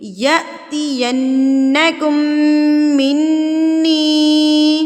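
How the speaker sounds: يَأْتِيَنَّكُمْ with a jolt on أ and a nasal نّ, slow; jolted recitation. Shown as a demonstration. Teacher recites the verse showing the jolt and ghunnah. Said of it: "جھٹکا دے کے پڑھیں گے".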